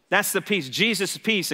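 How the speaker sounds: ordinary delivery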